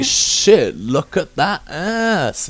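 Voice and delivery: high, stretched-out distracted admiration; acted gawping driver. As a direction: draw it out, mock-awestruck. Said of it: "I'm like".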